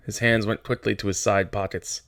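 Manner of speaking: ordinary delivery, nothing marked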